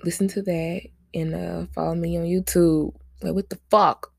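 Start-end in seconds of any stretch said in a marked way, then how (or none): 3.2-4.1 sudden loud outburst. Real, not acted.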